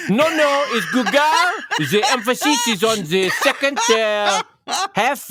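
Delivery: high, loud mock French accent, fussy correction; hammed-up French accent insisting on the right name. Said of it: "a northern French pronunciation of".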